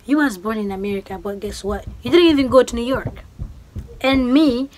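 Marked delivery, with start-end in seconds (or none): 2.0-4.7 louder, indignant push; emphatic louder retort; louder heated rant. Real, not acted. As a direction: raise the voice, scolding the doubter.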